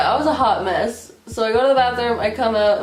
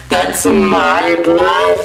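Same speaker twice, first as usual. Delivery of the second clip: deep, loud, drawn-out mock disbelief; drunken shock; 4 semitones lower, 8 dB louder, much slower than usual. Drunk daughter learning it's her blood, deep slow shock. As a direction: drag it out low and loud.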